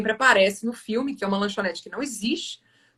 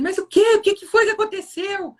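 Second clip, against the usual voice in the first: high, louder retold alarm; startled questioning; 10 semitones higher, 4 dB louder than usual. Boy hearing it will get worse, startled. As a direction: act out a boy demanding to know what happened.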